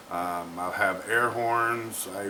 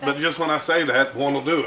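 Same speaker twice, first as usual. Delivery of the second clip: laughing; 5 semitones higher, 8 dB louder than usual. Real, not acted. Laughing at a joke.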